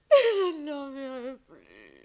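loud, slow wail of grief; sobbing loudly. Acted. Alone, the wife wails loudly for her lost lover. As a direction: let the sob burst out. She weeps for the departed lover.

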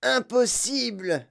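high, drawn-out slow refusal to believe; emphatic declaration. A narrator's reading. Mike Teavee denies what he sees, emphatic declaration. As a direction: stretch the word in disbelief. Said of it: "déclara Mike Teavee".

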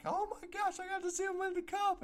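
high-pitched retold gushing; imitating excitement. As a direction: gush like a thrilled kid. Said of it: "I was just like".

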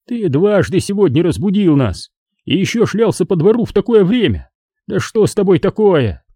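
high, exasperated reproach; scolding. A narrator's reading. Father with crossed arms at home, scolding. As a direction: tell off a child who woke the house. Father reprimands son for night noise.

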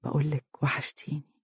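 very soft intimate whisper. A narrator's reading. Fares repeating his whisper to Mohra, very soft whisper. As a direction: whisper it again, close. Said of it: "وقال".

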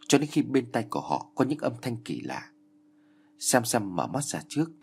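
ordinary delivery, nothing marked

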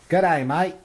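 high, loud, hammed-up Aussie accent; Australian accent. Shown as a demonstration. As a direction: go broad mock-Australian, rising at the end. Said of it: "what's your Australian accent like?".

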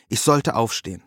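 ordinary delivery

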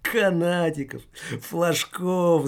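mocking echo through laughter; spiteful laugh. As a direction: laugh the words back at him. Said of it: "злобно расхохотала".

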